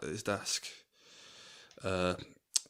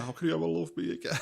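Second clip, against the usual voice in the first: high, wailing quote edged with laughter; Candy, mock crying; 9 semitones higher, 3 dB louder, faster than usual. Host mimicking a woman crying in the corner, mock tearful. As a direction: imitate a tearful plea, half laughing. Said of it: "Candy's just crying in the corner".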